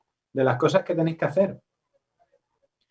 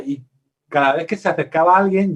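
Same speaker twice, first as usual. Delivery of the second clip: ordinary talk, nothing marked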